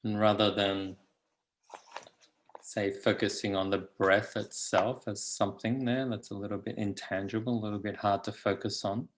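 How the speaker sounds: calm, unhurried guidance with long pauses; guided meditation. Acted. Teacher settling meditators into the body, calm guided meditation. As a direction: lead them gently into stillness.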